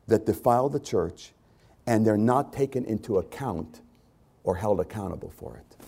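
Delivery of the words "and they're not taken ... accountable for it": usual speaking voice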